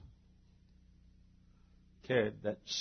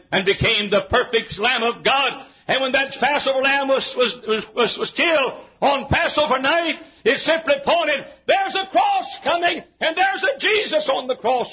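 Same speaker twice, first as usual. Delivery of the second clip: high, climbing pulpit cry; full-force preaching; 13 semitones higher, 10 dB louder than usual. Real, not acted. Preacher announcing a cross to come, full-force preaching. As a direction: build to a high proclamation.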